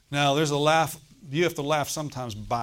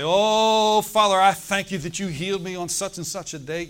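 joyful thanksgiving; 6 semitones higher, 9 dB louder than usual. Retold or imitated. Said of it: "I'd say".

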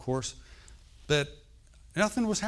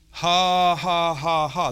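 loud, sustained, deliberate "ha"s; forced laugh by faith; 4 semitones higher, 9 dB louder, much slower than usual. Retold or imitated. Preacher re-enacting his month of laughing at the growth, forced. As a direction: push out a humorless laugh at full voice. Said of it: "This went on for about a month".

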